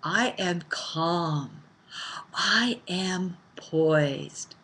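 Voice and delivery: soft, very slow and calm, each word spaced; calm affirmation. Shown as a demonstration. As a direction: speak it from a calm, powerful place inside. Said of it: "our affirmation for today is".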